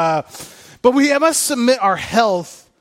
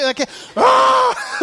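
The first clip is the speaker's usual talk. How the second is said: much higher sudden yelp of alarm; mock panic yelp; 4 dB louder than usual. Preacher miming a parent's panic over choking, high yelp. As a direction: let out a sharp fake alarm cry.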